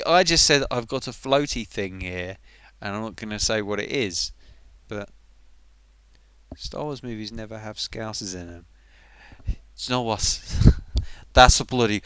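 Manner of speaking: ordinary delivery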